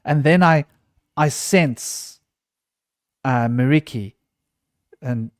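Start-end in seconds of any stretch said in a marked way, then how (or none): none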